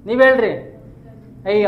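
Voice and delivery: ordinary talk, nothing marked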